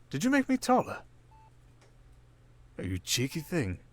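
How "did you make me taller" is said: high, amused disbelief; a teasing squeak of surprise; surprised. Acted. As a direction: pitch up in mock surprise. Discovering new cyborg body.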